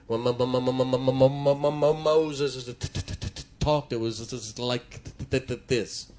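imitating a heavy stutter; demonstrated stutter. Shown as a demonstration. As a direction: get stuck repeating the first sound, then push through. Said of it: "means that he stuttered".

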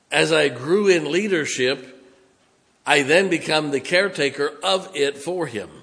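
loud, slow preaching with weight on every phrase; full-force preaching. Acted. Pastor preaching hard to his church, loud and slow. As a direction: drive it home to the congregation.

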